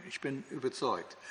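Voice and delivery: ordinary delivery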